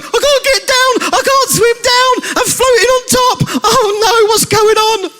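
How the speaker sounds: very high, squeaky cartoon panic; hammed-up high-pitched alarm; mock panic. Acted egg stuck floating, panicking.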